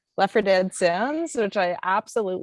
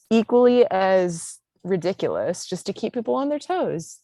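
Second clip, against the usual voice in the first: usual speaking voice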